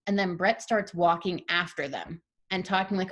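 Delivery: ordinary talk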